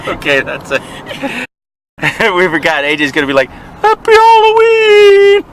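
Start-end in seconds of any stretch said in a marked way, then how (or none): 3.5-5.4 very high, loud, drawn-out squeal; hammed-up gleeful cheer. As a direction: stretch it out like an overjoyed, oblivious kid. Friend's cheer acted in joke.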